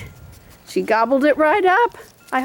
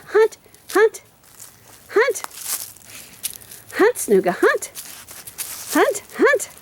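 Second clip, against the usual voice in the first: dog talk; 9 semitones higher than usual. Real, not acted. Urging dog to hunt.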